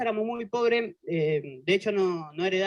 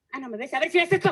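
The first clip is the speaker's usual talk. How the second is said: higher, louder mock threat from mom; mother about to hit; 5 semitones higher, 3 dB louder than usual. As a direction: threaten the kid like a fed-up mother. La mamá le pega. (madre amenazando al hijo, voz más aguda)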